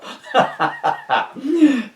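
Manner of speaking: sudden high giggling laugh; high burst of laughter. Real, not acted. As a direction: break into surprised laughter. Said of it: "Some of these take me out of the blue".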